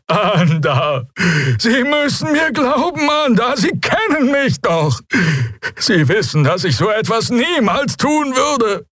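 high, desperate alien begging; pleading Draxyl. Acted. Prisoner begging his old protégé for trust, desperate. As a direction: implore him in a wailing high voice.

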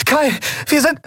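high call breaking off; call, then stop short; calling out cut off. Acted. (abgebrochener Ruf)

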